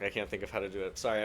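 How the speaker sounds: ordinary talk, nothing marked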